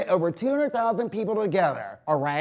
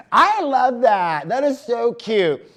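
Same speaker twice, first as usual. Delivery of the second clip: gushing; 5 semitones higher, 6 dB louder, slower than usual. Real, not acted. Gushing over cute pet photo.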